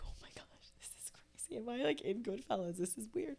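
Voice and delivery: soft, breathless whisper of disbelief; whispered inner thought. Retold or imitated. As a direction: whisper it like a secret to yourself. Retold inner thoughts at dinner.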